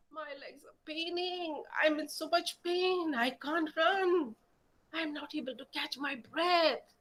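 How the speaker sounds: a kitten too tired to run. A narrator's reading.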